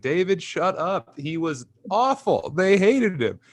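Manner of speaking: high, slow, scornful mom voice; imitating mom. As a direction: shut the dad down, high and gleeful. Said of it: "and she's like".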